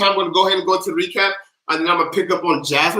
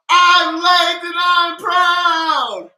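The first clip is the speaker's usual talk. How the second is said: high, drawn-out sung shout; proud scream; 9 semitones higher, 3 dB louder, much slower than usual. Shown as a demonstration. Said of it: "we are all going to scream".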